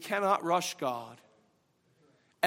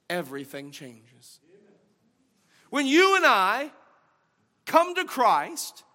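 loud, high preaching voice; fervent sermon delivery; 7 semitones higher, 9 dB louder than usual. Real, not acted. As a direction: preach it out to the church. Preaching at full force again.

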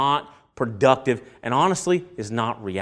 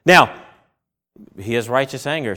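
ordinary talk

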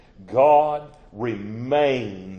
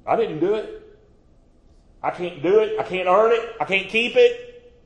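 each line pitched higher and louder; rising confession, louder each time; building preacher cry; 7 semitones higher, 5 dB louder than usual. Real, not acted. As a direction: climb with every phrase.

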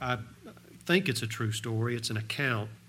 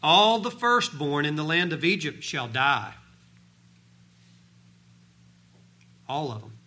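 louder, high-pitched scripture preaching; 6 semitones higher, 7 dB louder than usual. Acted. Preacher reads God's promises to the congregation, forceful preaching. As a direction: proclaim the verses with full conviction.

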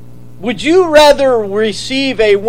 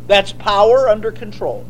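ordinary talk

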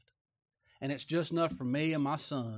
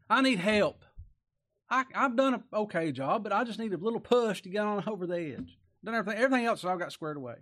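loud, higher, urgent begging; pleading; 7 semitones higher, 6 dB louder than usual. Acted. Woman begs the Lord for a little push, loud pleading. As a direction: push hard to be heard, almost desperate.

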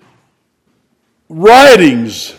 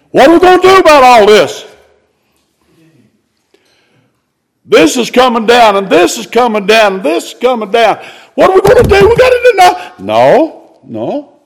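pitched high and urgent, pressing the question; high fervent pulpit call; fervent preaching; 5 semitones higher, faster than usual. Real, not acted. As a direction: preach it up, rising to a cry. Preacher rising to fervent climax.

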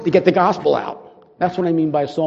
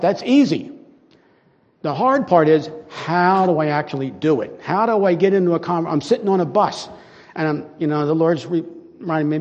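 usual speaking voice

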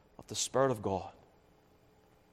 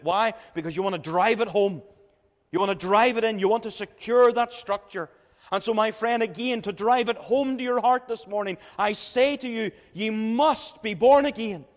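raised, pressing pulpit voice; fervent preaching; 5 semitones higher, 4 dB louder than usual. Acted. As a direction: preach it higher and louder, driving the point home. Preacher builds the hammer illustration.

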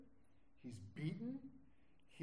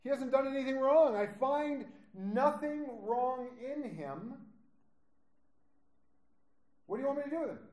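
higher, louder, pleading governor; pleading Pilate; 8 semitones higher, 7 dB louder than usual. Acted. Pilate pleading with the crowd before the trial, raised and urgent. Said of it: "Pilate goes out before all of them and says".

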